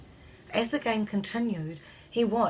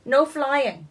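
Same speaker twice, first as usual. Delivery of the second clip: loud, higher call; cried out; 6 semitones higher, 7 dB louder than usual. A narrator's reading. Scottish fairy shouting at a flyer, loud. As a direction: call out a rule in protest. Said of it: "one of the Scottish fairies cried".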